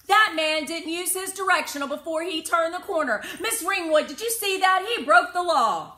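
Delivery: put-on high, loud, outraged kid; eager young rule-keeper calling out; indignant child tattling. A narrator's reading. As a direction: tattle at full volume like a rule-obsessed kid.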